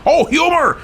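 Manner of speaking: sudden realization. Real, not acted. Host finds the word.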